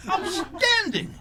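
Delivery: sarcastic joy at an air-conditioned tomb, high and slow; mock enthusiasm. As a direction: drag it out in fake delight. Mock delight at offer.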